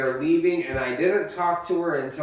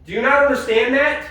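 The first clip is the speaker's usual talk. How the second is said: angry shouting; 4 semitones higher than usual. Real, not acted. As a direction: shout it, furious and red-hot. Shouting during fight over house.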